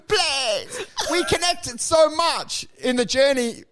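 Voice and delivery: high-pitched hammed-up begging; mock pleading. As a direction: beg like a heartbroken robot, high-voiced. Robot begs not to be abandoned.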